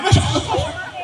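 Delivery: very high, loud squealing "Masya Allah"; awed woman. Acted. Fake Bu Nyai squealing in awe as women kiss her hand. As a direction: squeal it like an overwhelmed fake lady.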